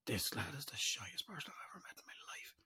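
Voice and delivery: whispered aside, no voice. Retold or imitated. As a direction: breathe it out as a secret. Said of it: "I was like".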